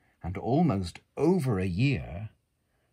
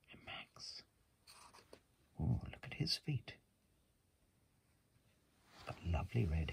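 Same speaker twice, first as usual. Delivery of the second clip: whisper; 2 semitones higher, 12 dB quieter than usual. Real, not acted. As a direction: whisper it gently over the book.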